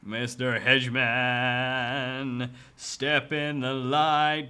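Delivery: very slow, loud drawn-out taunt; mock taunt. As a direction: call him out slowly, daring him. Taunting hidden creepy character.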